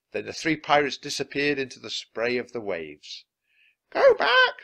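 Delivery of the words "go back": very high, loud bird voice; parrot squawk. A narrator's reading. Parrot warns the pirates, squawking high and loud. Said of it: "squawked Polly".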